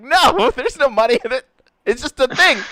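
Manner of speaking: words squeezed out through laughter; giggling no; laughing. Retold or imitated. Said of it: "can't stop laughing".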